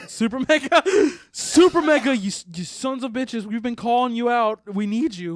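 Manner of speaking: loud, high shout-out; calling out. Real, not acted. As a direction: yell to get their attention. Calling out to YouTubers.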